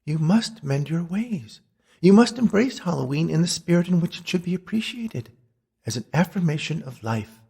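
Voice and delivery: high, earnest ghostly warning; ghostly Beatrice. Acted. Ghost warns old sweetheart to mend his ways, high and solemn. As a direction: warn him solemnly in a high woman's voice.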